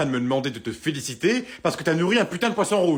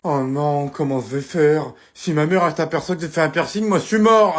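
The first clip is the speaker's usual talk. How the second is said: loud panic; 8 dB louder than usual. Acted. Panics about mother seeing piercing.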